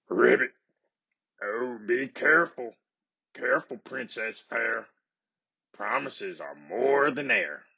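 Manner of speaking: a gentle singsong warning through the door; croaking frog warning. A narrator's reading. Frog warns the princess through the door, croaking. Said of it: "she could hear him croaking".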